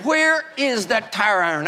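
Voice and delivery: slow, seething mock shout; retold furious thought. Pastor recalling his rage at a churchgoer, slow angry shout. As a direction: growl it out like you're hunting for a weapon. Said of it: "fury, rage".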